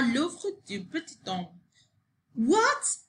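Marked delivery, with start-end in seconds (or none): 2.4-3.0 mild high-pitched exclamation; understated storybook outcry; shocked cry. A narrator's reading. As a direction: start high on the startled question. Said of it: "cried Sophie".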